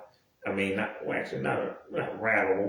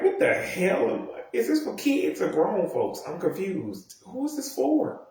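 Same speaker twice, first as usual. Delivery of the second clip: high-pitched, baffled questioning; mock bewilderment with the pitch lifted; confused; 7 semitones higher than usual. Viewer asking whether it's for kids or grown folks, confused. Acted confusion over show's audience.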